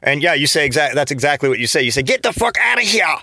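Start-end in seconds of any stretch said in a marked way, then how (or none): 2.0-3.2 high, strained "Get the fuck outta here"; mock exasperated yelp. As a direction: squeal it out, fed up with the hot water. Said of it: "why you say it like that".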